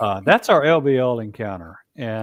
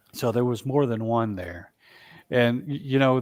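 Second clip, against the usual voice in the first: ordinary delivery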